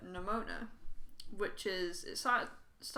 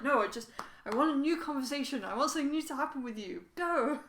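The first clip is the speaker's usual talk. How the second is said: acted-out impatience, pitched up and louder; impatient demand; 5 semitones higher, 6 dB louder than usual. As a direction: brush them off, ending on a high push. Impatient player talking to game characters.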